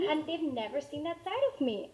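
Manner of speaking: normal talking voice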